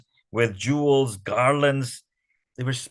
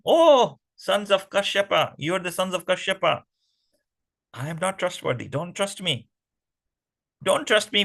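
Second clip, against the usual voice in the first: teasing Mohini; 6 semitones higher than usual. Acted. Mohini warns the demons not to trust her, playful high voice. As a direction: tease them with mock warning.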